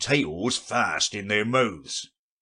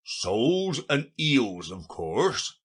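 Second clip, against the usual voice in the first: slow, put-out answer; the obvious, said wearily; rather impatient; 5 semitones higher, slower than usual. A narrator's reading. Gryphon answers Alice's silly question, a touch impatient. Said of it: "the Gryphon replied rather impatiently".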